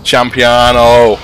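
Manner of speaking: Champiano stretched very slowly, loud and high; silly drawn out. Real, not acted. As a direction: drag out Champiano like a silly announcer. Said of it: "mangled word said drawn out".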